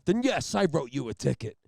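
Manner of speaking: loud trooper speech with dramatic pauses; mock self-important cop lecturing the room; Jack Nicholson. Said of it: "there's pauses and shit".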